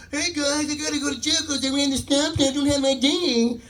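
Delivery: high put-on mocking voice; high-pitched mock bragging; cocky cyclist impression. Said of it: "getting all cocky".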